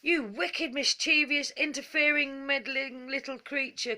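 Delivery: furious wizard scolding the pixie; angry cry. A narrator's reading. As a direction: hurl the insults at the pixie. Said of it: "cried the angry wizard".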